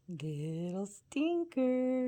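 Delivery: 'stinker' stretched out slowly; slow, stretched 'stinker' teasing the bird. Real, not acted. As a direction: draw out 'Little stinker' fondly.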